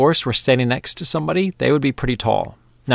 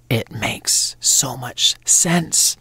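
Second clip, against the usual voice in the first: slow, breathy whispered aside; much slower than usual. Real, not acted. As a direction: whisper it as if it's a delightful secret. Whispered aside about the name.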